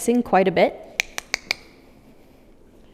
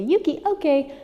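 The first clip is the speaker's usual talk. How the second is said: high, squeaky call to the dog; 6 semitones higher than usual. Retold or imitated. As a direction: pitch it way up, squeaky and bright. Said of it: "my really high squeaky voice".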